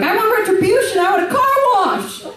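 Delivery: very high mock scream; retold shrieking demand, high-pitched. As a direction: shriek it through a glass door. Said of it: "I'm screaming through the glass door".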